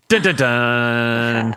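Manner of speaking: slow mock suspense sting; dramatic dun dun dun. Host teasing the original ending, mock suspense. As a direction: hum a spooky reveal.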